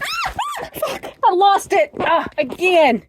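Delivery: high scream then loud angry cursing; frustrated shout. Real, not acted. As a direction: yell at losing the bird again. Bird escapes again.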